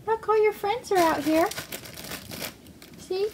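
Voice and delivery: high cheering pet voice. Real, not acted. Owner cheering her rat on, high encouraging baby talk.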